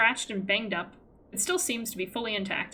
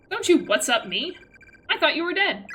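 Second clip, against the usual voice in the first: loud, higher-pitched indignant outburst; acted exasperated relief, raised voice; indignant scolding; 6 semitones higher, 6 dB louder than usual. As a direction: scold a friend who gave you a scare. Scolds friend who seemed dead.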